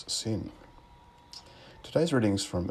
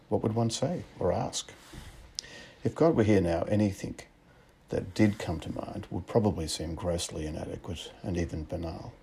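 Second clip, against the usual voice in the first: ordinary talk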